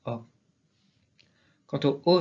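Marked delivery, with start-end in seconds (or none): 0.0-0.2 O said as an open vowel; open-mouthed O sound. Shown as a demonstration. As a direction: give O an open, French "or" vowel. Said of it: "se prononce toujours ouvert".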